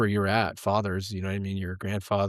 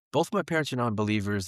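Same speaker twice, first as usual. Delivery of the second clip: ordinary talk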